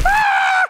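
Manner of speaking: loud, very high shriek; hammed-up terrified screech; falling scream. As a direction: scream as if plummeting. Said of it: "I'm screaming".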